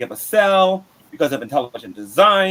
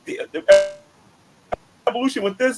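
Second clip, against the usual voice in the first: mock fake-atheist voice; 7 semitones higher than usual. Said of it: "and I said".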